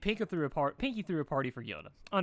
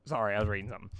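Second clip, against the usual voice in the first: ordinary delivery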